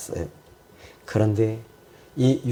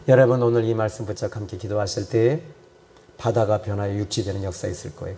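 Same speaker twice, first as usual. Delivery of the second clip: ordinary talk, nothing marked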